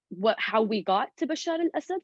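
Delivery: ordinary delivery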